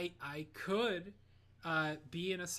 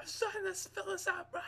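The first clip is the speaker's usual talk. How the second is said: high, loud mock hollering; drill sergeant; 5 dB louder than usual. Drill sergeants storming around during paperwork, shouting. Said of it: "we had these drill sergeants".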